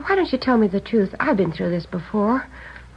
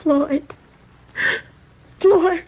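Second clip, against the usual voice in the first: rising, louder despairing call of the name; anguished cry; 8 semitones higher, 4 dB louder than usual. Acted. Sister hearing her brother is wanted for murder, anguished cry. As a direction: cry out his name in grief.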